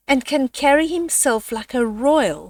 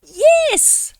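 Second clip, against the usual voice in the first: high loud shouted yes; the animals shout yes together; 6 dB louder than usual. Acted. Animals answering the owl with a loud shouted yes. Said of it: "shouted the animals".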